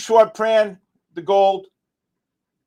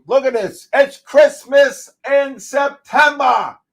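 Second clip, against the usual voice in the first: higher, louder, slow drawn-out cheer; gleeful shout; 6 semitones higher, 6 dB louder, slower than usual. Real, not acted. Streamer cheering the drop to viewers, slow gleeful shout. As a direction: stretch every word out in delight. Big winning trade on stream.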